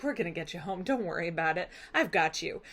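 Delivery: low soft comforting voice; calm a shaken traveller; reassuring king. Acted. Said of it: "he's like".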